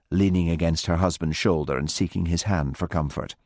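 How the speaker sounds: usual speaking voice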